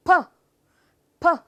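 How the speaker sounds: "ਫ" blown onto a finger; short airy "ਫ" bursts; puffed P. Shown as a demonstration. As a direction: pop "ਫ" with strong breath. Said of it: "ਕੀ ਫੂਕ ਵੱਜਦੀ ਹੈ".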